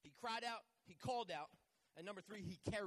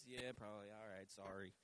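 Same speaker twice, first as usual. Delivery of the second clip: sheepish mock apology; 10 semitones lower, 6 dB quieter than usual. Sheepish mock apology after teasing.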